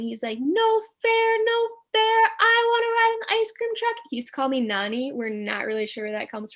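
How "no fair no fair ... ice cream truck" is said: put-on high, loud childish whine of a little brother; whiny yelling little brother. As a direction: wail it like a kid denied ice cream. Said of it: "my brother is, like, yelling".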